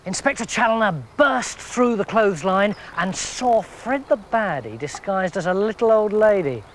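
plain reading voice

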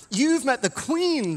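slow, high, loud thrilled question; an astonished tourist gushing; excited. Retold or imitated. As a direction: starstruck tourist gasping at the news. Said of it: "they asked him very excitedly".

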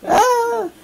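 laughing squeal from tickling; being tickled. Real, not acted. She's tickling me.

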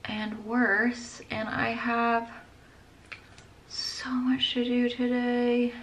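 fading to a soft whispered groan, slow; weary from a headache. Real, not acted. Vlogger with a headache trailing off into a whisper. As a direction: let the pain drain the voice away. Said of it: "honestly it's just getting worse".

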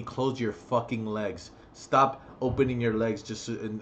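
ordinary talk

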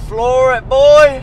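high, very loud whoop; excited shout. Real, not acted. Driver flooring it onto the motorway, shouting excitedly. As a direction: yell it out at full pelt.

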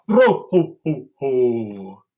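jolly Santa laugh. Shown as a demonstration. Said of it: "big Santa belly".

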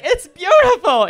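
very high, loud gushing mock awe; a mock swooning theatregoer; gushing audience member. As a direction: squeal it like a starstruck Minnesota fan.

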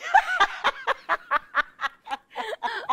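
high burst of laughter; real high-pitched cackle; loud laugh. Real, not acted. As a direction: crack up loudly.

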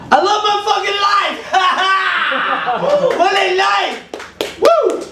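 high, laughing shout of joy; mock rock-star euphoria; excited shout. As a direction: whoop it out like a giddy fan.

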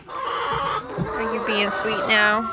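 slow, drawn-out coo to a hen; cooing to a pet. Real, not acted. Cooing to the buff hen hopping onto her, slow. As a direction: stretch it out like talking to a pet.

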